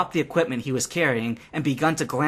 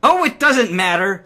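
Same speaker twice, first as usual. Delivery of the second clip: loud, higher yell; a loud exasperated yell; 7 semitones higher, 7 dB louder than usual. A narrator's reading. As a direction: shout him down in anger. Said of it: "she yelled".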